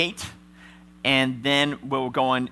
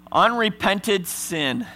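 each word slow and weighty, raised; slow solemn stress; 6 semitones higher, much slower than usual. Real, not acted. Preacher warning the church, slow and heavy. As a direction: lay it down heavy.

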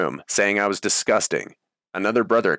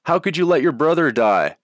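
roaring; 8 semitones higher, 4 dB louder than usual. Retold or imitated. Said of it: "The room roared and continued".

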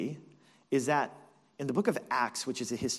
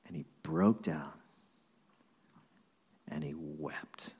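hushed sorrowful narration; 2 semitones lower, 6 dB quieter than usual. A narrator's reading. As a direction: let it fall away into a hush. Narrates Peter breaking down weeping.

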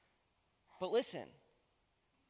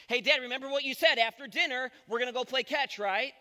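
higher, louder, fast and excited; eager kid; 6 semitones higher, 7 dB louder than usual. Retold or imitated. Dad imitates son Bo at dinner, eager and fast. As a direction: burst out reminding Dad of the promise. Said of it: "the first thing Bo says is".

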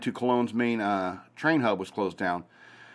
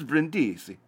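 higher, put-on Italian accent; 3 semitones higher, slower than usual. Shown as a demonstration. Host showing off the Italian town name, playful Italian accent. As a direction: relish Brindisi with an Italian accent. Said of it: "trying to get the accent right".

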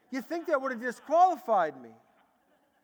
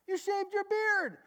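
high, insistent student voice; lightly voiced students pressing the point; 9 semitones higher than usual.

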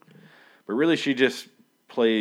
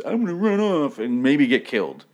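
mock heroic quote; 7 semitones higher, 6 dB louder than usual. Acting out a character's choice.

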